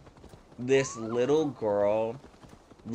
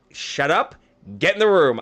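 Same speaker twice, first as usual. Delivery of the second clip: loud, higher-pitched mock-stern command; retold sharp order; 3 semitones higher, 7 dB louder than usual. Offended teacher silences kid, retold loud sharp order. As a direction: act out cutting a kid off.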